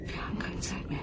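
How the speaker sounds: urgent whisper. Acted. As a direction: whisper as if time is running out. Sprecherin flüstert durchgehend. (dringendes Flüstern)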